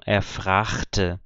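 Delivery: Colônia-style fragte; regional fragte. Shown as a demonstration. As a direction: say fragte with a ch sound, not k. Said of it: "as pessoas falavam".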